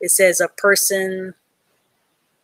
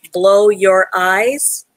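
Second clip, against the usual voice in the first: slow careful reading; 5 dB louder, much slower than usual. Shown as a demonstration.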